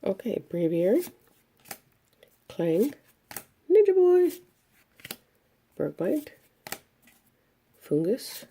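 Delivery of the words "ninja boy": sudden high thrilled shout; excited. Real, not acted. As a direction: blurt it out, thrilled by the pull. Excited card pull.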